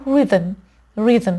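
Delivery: rhythm with a short, quick "i"; short vowel. Shown as a demonstration. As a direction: keep the first vowel of rhythm brief.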